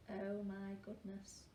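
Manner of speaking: ordinary delivery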